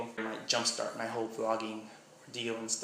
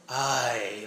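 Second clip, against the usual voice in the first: a Miami "Ay," stretched out in a Miami accent; drawn-out Miami "Ay"; 5 dB louder than usual. Shown as a demonstration. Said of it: "something from Miami might sound like".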